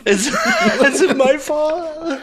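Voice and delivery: high, slow, laughing mock child. Joking about a divorce, slow laughing child voice. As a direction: play the guilty kid, laughing.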